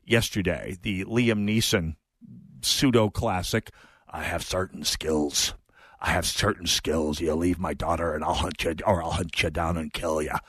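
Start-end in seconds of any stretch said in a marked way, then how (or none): 4.1-5.5 Liam Neeson take one. Retold or imitated. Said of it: "my best attempt at a Liam Neeson accent". 6.0-7.2 Liam Neeson take two. Retold or imitated. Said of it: "my best attempt at a Liam Neeson accent". 7.2-10.3 Liam Neeson impression. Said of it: "That's my best attempt at a Liam Neeson accent".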